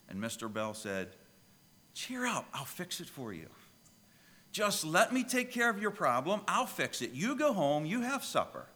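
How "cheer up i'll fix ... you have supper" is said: put-on high, bright comfort for a child; reassuring old man. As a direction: cheer the little girl up. Mister Bell comforts the crying girl.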